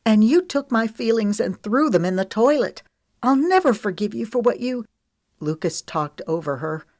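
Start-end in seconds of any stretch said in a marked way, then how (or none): 0.1-4.8 louder, higher outburst of hurt; mildly furious, voice pitched up; accusing him louder and higher; trembling fury. A narrator's reading. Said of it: "her voice trembling with fury".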